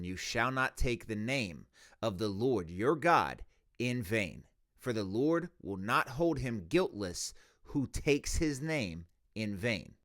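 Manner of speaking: quiet, measured Bible reading; scripture reading. Acted. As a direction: read the verse softly and deliberately. Reads Exodus verse aloud.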